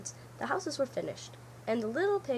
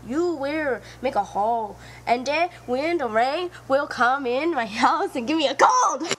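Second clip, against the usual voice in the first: louder, urgent pig voice; distressed pleading; 3 semitones higher, 7 dB louder than usual. Acted. Sing Shu pleads with the fox, loud and upset. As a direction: plead with rising panic.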